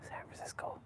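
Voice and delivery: San Francisco whispered under the breath; whispered to self. Real, not acted. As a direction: whisper San Francisco to yourself, trying to remember. Muttering to self, recalling name.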